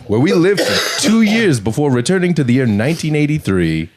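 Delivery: giggly mock British accent. Playing the time-traveling witness in mock British accent. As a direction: keep the British witness going, laughing. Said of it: "You sound like Boris Johnson".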